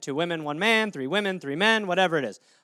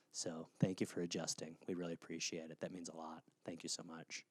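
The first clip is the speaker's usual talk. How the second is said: much lower, soft, breathy-smug thank-yous; mock smug couple; 21 dB quieter than usual. Duple couple thanking people for adjusting, hushed smug murmur.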